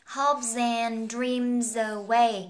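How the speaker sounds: "Hopes and dreams away" drawn out slowly, run together as hope-zand dream-zaway; linked words. Shown as a demonstration. Teacher showing learners the linking, "Hopes and dreams away" slowly joined up. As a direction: link each final z in "Hopes and dreams away" onto the next vowel. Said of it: "mình có nối âm này".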